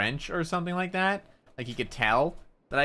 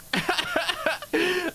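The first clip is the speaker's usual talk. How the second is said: high laugh. Real, not acted. Laughs at own rant.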